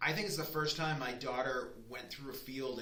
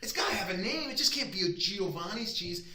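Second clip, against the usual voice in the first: higher and louder, mock protest; exasperated retort; 6 semitones higher, 5 dB louder than usual. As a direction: push back at dad, amused. His retort to dad.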